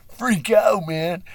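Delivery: high loud yelp of panic; hammed-up panicked yell; mock freak-out. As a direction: yelp like someone losing it. Imagined victims panicking.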